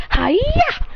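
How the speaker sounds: put-on high, sharp kid's karate yell; mock battle cry. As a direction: shout it as a playful attack. Boruto attacks with a chop.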